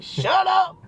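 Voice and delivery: high-pitched mock yell; crazy girlfriend. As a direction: squawk it like an exasperated girlfriend. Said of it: "she gets crazy".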